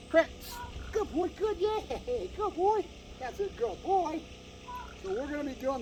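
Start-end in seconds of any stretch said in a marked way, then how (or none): 0.1-4.2 higher, cheerful praise; happy dog praise. Real, not acted. Trainer rewarding Prince after the click, high and excited. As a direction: lift your voice to reward him.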